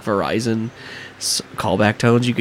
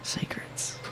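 whisper; 16 dB quieter than usual. Acted. Secret pirate pub whisper.

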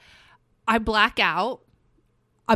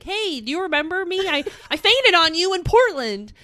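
higher, lilting retold hello; eager fan greeting; 7 semitones higher, 4 dB louder than usual. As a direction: re-enact shyly reminding an idol. Acting out greeting the singer.

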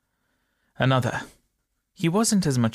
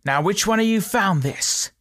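loud, higher demand to the crowd; bobby shouting; 7 semitones higher, 4 dB louder than usual. A narrator's reading. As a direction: bark the question at the whole crowd. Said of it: "and shouted".

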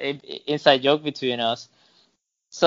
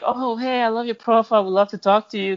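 high, gushing mock admirer; lightly put-on higher voice, overly friendly; imitated eager newcomer; 7 semitones higher than usual. Imitating a newcomer messaging him on a dating profile, mock-eager.

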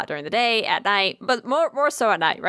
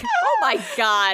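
exasperated shriek; slower than usual. Real, not acted. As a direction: squeal it out in mock exasperation. Exclaims at the memory of Blake.

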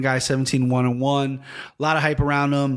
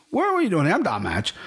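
playful fighter role-play; 6 semitones higher than usual. Acted. Host plays fighter introducing himself, playful swagger. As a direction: jump up high, then settle for the introduction. Acting out the cool-named fighter.

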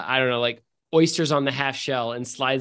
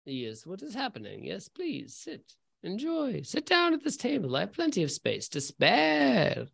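friendly stranger; 4 semitones higher, 6 dB quieter than usual. Acted. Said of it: "he says".

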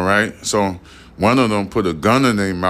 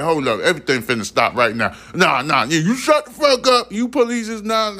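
fast, much higher pitched alien ordering people around; bossy alien taking charge, pitched way up; alien commander; 10 semitones higher than usual. Pretend. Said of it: "somebody come out that bitch like".

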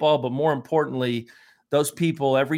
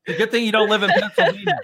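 laughing; 10 semitones higher, 7 dB louder, faster than usual. Real, not acted. Joking about alcohol, laughing.

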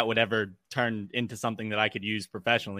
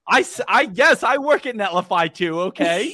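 high, loud, mock-offended protest; jokey defensive outburst; playfully indignant; 8 semitones higher, 5 dB louder than usual. Real, not acted. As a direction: insist on it, pretending to be affronted. Mock-offended reply to doubting cohost.

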